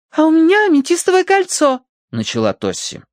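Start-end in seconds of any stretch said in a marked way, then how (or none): 0.1-1.8 put-on very high girlish voice; whiny daughter. A narrator's reading. Daughter adds her ring for the constable, girlish. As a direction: chime in about your own loss.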